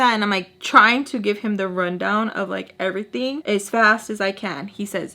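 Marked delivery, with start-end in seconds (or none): none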